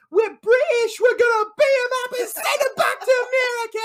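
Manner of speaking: high, loud, hurried British tough-guy caricature; hammed-up patriotic brawler's shout; Vinny Jones. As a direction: rant it like a mock hard man. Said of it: "Vinny says".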